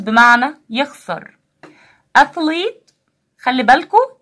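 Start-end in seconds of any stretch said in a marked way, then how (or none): 2.2-2.9 Athlete with a clear th like ث; teacher modelling the th sound in Athlete; model th sound. Shown as a demonstration. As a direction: stress the th in Athlete. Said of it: "ث".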